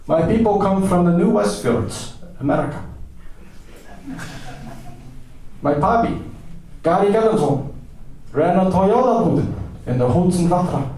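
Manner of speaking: high, proud recital of lineage; pompous madman introducing himself; deranged American eccentric. Acted. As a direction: declaim it grandly in a put-on high voice.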